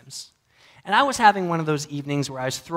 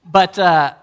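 much louder, emphatic restart; 3 semitones higher, 14 dB louder than usual. Real, not acted. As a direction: grab the room back. Loud restart after laughter.

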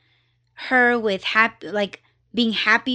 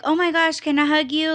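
higher-pitched, eager request; excited woman; 6 semitones higher, faster than usual. Retold or imitated. Woman asking a stranger for a hug, excited. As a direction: imitate her happy, eager gush. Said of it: "she was so excited".

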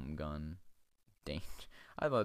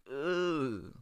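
long, higher groan of revulsion; disgusted ugh; 7 semitones higher than usual. Real, not acted. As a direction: recoil with a drawn-out ugh. Grossed out by willy.

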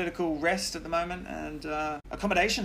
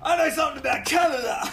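higher, louder, a touch fast and laughing; mock gunman; 10 semitones higher, 4 dB louder, faster than usual. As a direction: lampoon the gunman's boast. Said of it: "sort of say".

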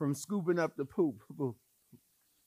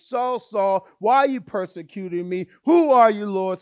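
loud heavenly calling; 5 semitones higher, 12 dB louder than usual. Acted. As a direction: call out from heaven. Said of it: "heard a voice".

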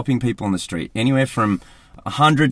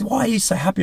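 higher, lightly mocking quizzical voice; puzzled housemates; 10 semitones higher, 3 dB quieter, faster than usual. Housemates asking why he's so cheerful, light mock puzzlement. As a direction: play the baffled flatmate. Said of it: "everyone would always".

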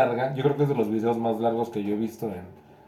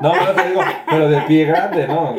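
laughing; 3 semitones higher, 5 dB louder than usual. Real, not acted. Joking through laughter.

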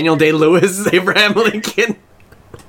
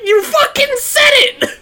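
much higher and slower, a laughing squeal; mock heckler shriek; 3 dB louder than usual. An imagined fan heckling Abraham Lincoln, squealing.

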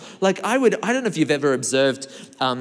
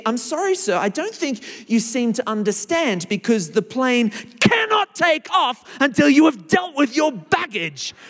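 stern hostess, octave up; 8 semitones higher than usual. Retold or imitated. Flight attendant insists passenger stows bag, much higher and firm. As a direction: raise the pitch and insist like a fed-up hostess. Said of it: "the octave in her voice changes".

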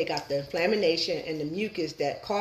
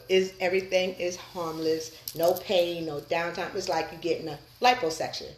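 usual speaking voice

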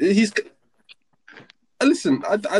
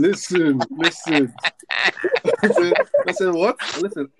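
laughing; 7 semitones higher, 4 dB louder, slower than usual. Real, not acted. Laughing while trying to speak.

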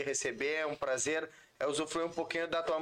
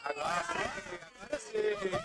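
excited high. Real, not acted. Reacting to catchphrase.

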